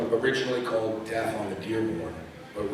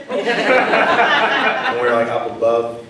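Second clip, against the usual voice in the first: laughing; 3 semitones higher, 14 dB louder, much slower than usual. Real, not acted. Laughs recalling favorite scene.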